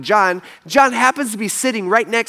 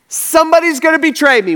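high, loud acted outburst; blurted out; 11 semitones higher, 5 dB louder than usual. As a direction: blurt it out as if unable to hold it in. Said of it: "it just blurts out of his mouth".

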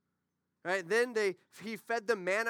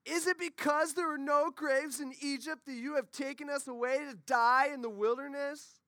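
higher-pitched mock complaining; whining Israelites; 6 semitones higher than usual. Israelites complaining to Moses in the wilderness, whiny mock protest. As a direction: whine it out like an aggrieved crowd. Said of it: "They sounded like this".

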